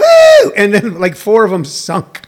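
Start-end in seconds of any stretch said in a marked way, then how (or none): 0.0-0.5 high, loud mock "woo"; imitated whoop. Imitating boaters running around on the lake, high loud whoop.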